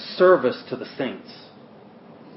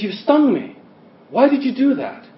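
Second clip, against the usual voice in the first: high-pitched, louder cry of pain and shock; mock scream; 12 semitones higher, 5 dB louder than usual. Duck screams at the scorpion in midriver, high mock scream. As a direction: shriek it like the stung duck, much higher. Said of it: "the duck screams out".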